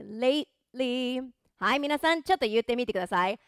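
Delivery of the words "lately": slow "lately" with a small gap for the T; held, unreleased T. Shown as a demonstration. Teacher modelling the word for learners, "lately" with swallowed T. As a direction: pause on the T of "lately" like a small Japanese tsu. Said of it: "日本のつみたいに".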